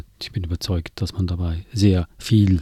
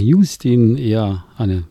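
normal talking voice

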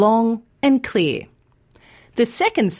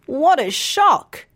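slow, higher mock shock at the real price; 6 semitones higher, slower than usual. Teacher voicing a friend shocked at the bill, slow and higher. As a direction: act out a drawn-out shocked gasp of words.

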